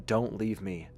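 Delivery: plain reading voice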